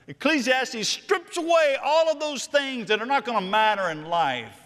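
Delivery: high-pitched, impassioned sermon delivery; fired-up preacher exhorting the congregation; forceful preaching. Real, not acted.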